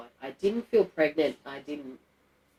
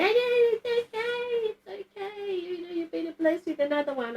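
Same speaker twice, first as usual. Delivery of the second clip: consoling mother; 14 semitones higher than usual. Retold or imitated. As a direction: imitate a fussing mum cooing reassurance. Imitates mother consoling her.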